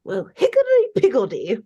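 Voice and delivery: lightly put-on British curse; mock British cursing. Big Ben cursing at being matched, mock British outburst. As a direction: play the silly curse as if it were strong language. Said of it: "Big Ben curses with a".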